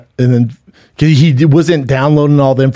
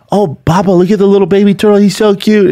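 high, quick imitation of a delighted kid; excited little boy; 8 semitones higher, 4 dB louder than usual. Son spotting a baby turtle with dad, excited child voice. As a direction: play a child cooing over something tiny. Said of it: "T goes".

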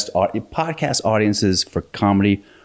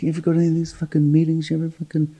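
higher, fast mock tough-guy voice; lightly voiced pushy extra, higher and quick; imitating a skinhead extra; 8 semitones higher, faster than usual. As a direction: rattle it off as the shady extra sizing him up. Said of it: "He came to me, like".

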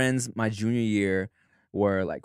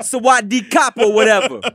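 put-on loud, high Thai accent bit; mock Thai accent, big and loud; 9 semitones higher, 9 dB louder than usual. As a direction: throw out a hammed-up Thai accent. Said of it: "That's the accent you wanted?".

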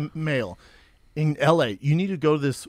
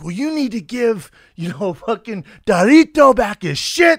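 mock angry shout; 6 semitones higher, 7 dB louder than usual. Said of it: "He's like".

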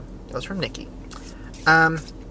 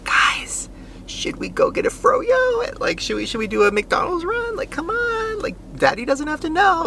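high, hushed conspiratorial voice; sneaky fun dad. Retold or imitated. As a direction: whisper like a sneaky fun parent. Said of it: "sneaks in their room at one in the morning".

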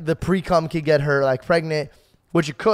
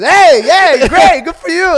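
much higher, louder cheering dad; 12 semitones higher, 11 dB louder, slower than usual. Retold or imitated. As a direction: cheer like a proud father. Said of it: "He goes".

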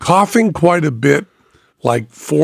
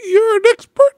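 much higher, thin falsetto question; squeaky broken voice. Retold or imitated. Imitating his broken voice.

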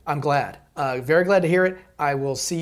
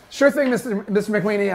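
nervous; 6 semitones higher, 5 dB louder, faster than usual. Acted. Nervous high placating.

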